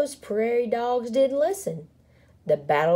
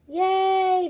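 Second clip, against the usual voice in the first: high, drawn-out cheer; 12 semitones higher than usual. A narrator's reading. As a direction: cheer happily as a crowd. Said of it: "the crowd cheered".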